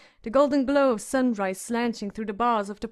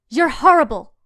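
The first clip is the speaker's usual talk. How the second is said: shrill, much louder scream; 4 semitones higher, 11 dB louder than usual. A narrator's reading. Sansa screams at her sister over the orange. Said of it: "she screamed at her sister".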